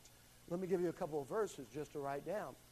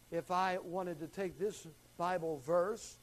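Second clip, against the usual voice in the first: normal talking voice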